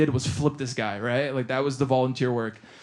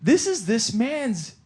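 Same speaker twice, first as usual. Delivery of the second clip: high, slow, tearful epiphany; hammed-up reverence on the verge of tears; mock choked up; 8 semitones higher, slower than usual. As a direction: imitate being overcome with emotion. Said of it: "I got, like, really choked up".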